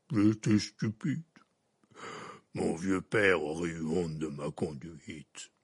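low, slow half-giant voice; deep, sorrowful giant; Hagrid voice. A narrator's reading. Hagrid answers Dumbledore, low slow giant voice.

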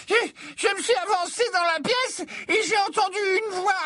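a dithering prophetess confessing reluctantly; flustered seer. Acted. Trelawney stammering explanation.

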